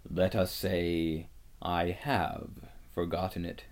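low, measured, grave lion; solemn and unruffled, voice dropped. A narrator's reading. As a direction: answer with slow weight and restraint. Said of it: "answered Aslan gravely".

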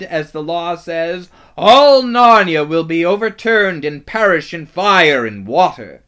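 loud, high witch tirade; piercing, scornful villainess at full volume; savage near-snarl. A narrator's reading. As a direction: rage imperiously, building to a ringing doom. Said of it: "savage smile that was almost a snarl".

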